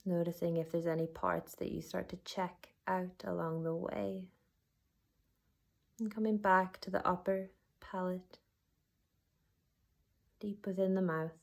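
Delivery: unhurried body-scan guidance with long pauses; guided meditation. Acted. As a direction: guide them gently inward, letting each phrase settle.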